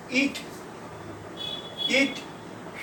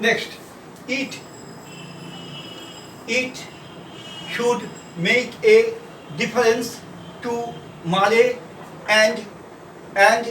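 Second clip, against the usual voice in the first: slow dictation; much slower than usual. Acted. Dictating sentences to a class.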